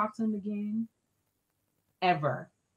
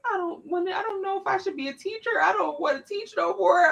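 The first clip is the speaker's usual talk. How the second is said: higher, a touch of sobbing despair; wife crying to her husband in the car, higher and tearful; retold crying; 10 semitones higher than usual. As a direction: whimper that you're done teaching. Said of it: "bust out crying".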